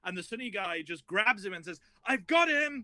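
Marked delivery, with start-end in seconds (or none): 2.0-2.8 higher, a hint of triumphant betrayal; a lightly voiced gleeful traitor grabbing him; triumphant gotcha cry, mildly done; betrayer's gotcha. Sunni friend betrays Vasafi.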